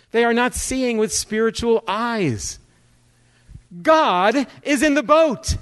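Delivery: impassioned sermon delivery; emphatic preaching. Real, not acted. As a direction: drive the point home to the congregation.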